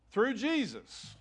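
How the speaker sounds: "Jesus" slow and weighted, then falling; "Jesus" stretched out for emphasis; emphatic scripture reading. Real, not acted. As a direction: lean slowly on "Jesus". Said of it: "The emphasis on the different words".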